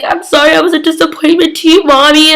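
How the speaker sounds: high-pitched mock pleading; imitated pleading apology. Elena apologizes to her mom, imitated pleading.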